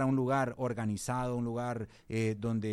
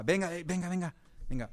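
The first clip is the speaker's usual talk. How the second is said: raised, coaxing father voice; firm father; 4 semitones higher than usual. Retold or imitated. A father calling his daughter to correct her, raised. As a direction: call her over firmly. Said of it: "como yo identifiqué con mi niña".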